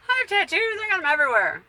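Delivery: high, loud proud claim; real proud outburst about her tattoos; emphatic insistence. Real, not acted.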